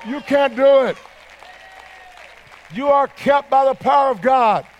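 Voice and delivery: usual speaking voice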